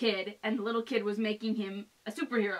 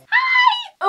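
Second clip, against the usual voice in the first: excited squeal; 13 dB louder than usual. Real, not acted. Bursting greeting.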